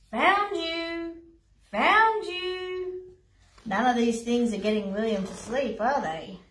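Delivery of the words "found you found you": high, sing-out hide-and-seek call; playful call. Acted. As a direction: call it out gleefully, stretching the words. Lion playing hide and seek.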